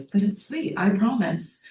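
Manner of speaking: put-on high-pitched child voice; pleading little daughter. Daughter reassuring her mom about the bobcat, high pleading child. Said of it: "the daughter goes".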